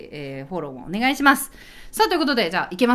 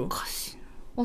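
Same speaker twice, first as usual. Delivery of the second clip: whisper; 2 semitones lower, 12 dB quieter, faster than usual. Real, not acted. Muttered aside, unvoiced.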